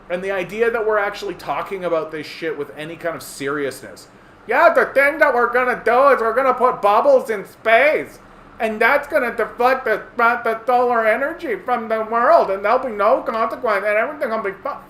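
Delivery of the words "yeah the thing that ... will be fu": high, loud mock-earnest voice of a tech optimist. Mocking the bubbles-in-space crowd in a high, loud voice. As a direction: ham up the smug plan-maker, pitched high.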